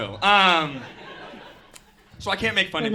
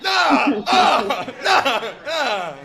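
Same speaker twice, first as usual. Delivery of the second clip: laughing shouts; 5 semitones higher, 7 dB louder, much slower than usual. Real, not acted. Reacting to police siren.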